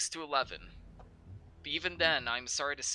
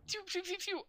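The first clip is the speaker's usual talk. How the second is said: blaster sound effect; 4 dB quieter than usual. Acted. Imitating blaster shots.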